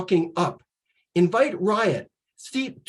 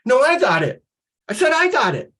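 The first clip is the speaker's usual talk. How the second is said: calling out; 6 semitones higher, faster than usual. Acted. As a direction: call for the ball, more insistent. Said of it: "and call out".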